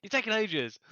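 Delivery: high, loud exasperated chivvying; mock impatience. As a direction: hurry them up in playful exasperation, pitched right up. Acts out impatience at normal-speed podcasts.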